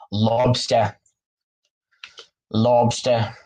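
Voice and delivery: lobster said as "lobstah", dropping the r in a light Boston accent; "Lobster" with a Boston broad o and no final r; Boston accent, lightly tried. Shown as a demonstration. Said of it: "I'm trying to do the Boston accent".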